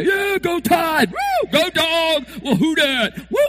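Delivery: high, loud whooping fan cheers; mock fan cheering. Preacher mimics fans cheering their team win. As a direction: ham up a cheering football crowd.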